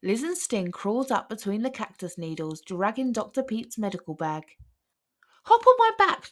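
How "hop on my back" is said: very high, loud cry; excited shout; eager call. A narrator's reading. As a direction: snake offering a ride. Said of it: "Jake cried".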